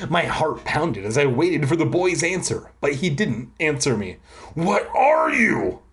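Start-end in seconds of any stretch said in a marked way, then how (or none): 4.5-5.8 high, drawn-out cry. A narrator's reading. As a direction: cry the question out, pitch way up. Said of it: "I cried out".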